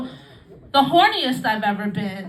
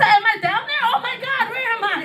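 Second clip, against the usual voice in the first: lost and panicked; 10 semitones higher than usual. Retold or imitated. Retelling her panic to the girl in bed, high and flustered. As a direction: sound like you've lost your bearings in bed. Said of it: "I said".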